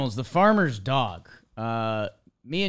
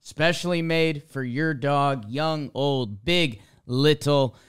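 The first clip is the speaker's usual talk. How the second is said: punchy podcast sponsor read with stressed key words; emphatic, measured ad-read delivery; 2 semitones higher, 6 dB louder than usual. Acted. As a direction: sell it like an upbeat announcer. Reads a sponsor ad.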